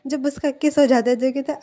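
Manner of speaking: usual speaking voice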